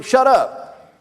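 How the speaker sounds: a high, sharp "shut up"; mock Elihu. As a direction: snap it like a rude reply. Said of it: "Then verse thirty-one and thirty-three".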